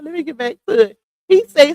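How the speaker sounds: usual speaking voice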